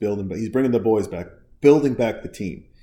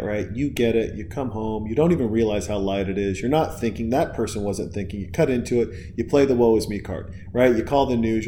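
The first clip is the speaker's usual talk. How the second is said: ordinary talk, nothing marked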